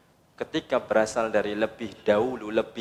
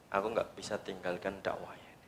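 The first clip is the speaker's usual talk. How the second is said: whisper; 6 semitones lower, 13 dB quieter than usual. Acted. Prophet's vow, hushed.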